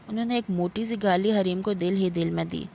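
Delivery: ordinary delivery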